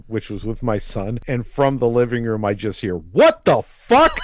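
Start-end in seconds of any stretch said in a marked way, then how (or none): 3.0-4.2 loud, high, drawn-out cry of disbelief; astonished shout. Retold or imitated. Son overheard from the living room, astonished shout. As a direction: call it out, stretched and startled.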